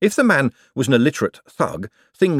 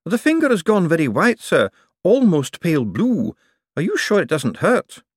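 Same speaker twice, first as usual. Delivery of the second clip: higher, anxious underling voice; timid worried servant, pitched up; nervous secretary; 8 semitones higher than usual. Acted. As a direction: fret over the boss's finger.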